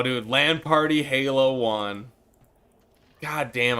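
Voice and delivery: ordinary talk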